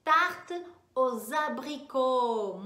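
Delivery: "Tarte aux abricots" slow and loud, every syllable clear; slow French model. Shown as a demonstration. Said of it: "vamos acrescentar aí nessa lista".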